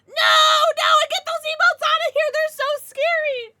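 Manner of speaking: very high, loud shriek; mock fright. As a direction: shriek away from the emotes. Reacting to scary emotes.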